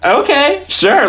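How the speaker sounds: high, hesitant agreement; going along with it. Acted. Character answering a surprise proposition, high-pitched going along. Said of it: "that character was just like".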